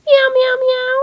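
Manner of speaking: high, loud kitten mews; kitten meows. Acted. Kittens mew after losing their pie, high meows. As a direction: mew like sad little kittens.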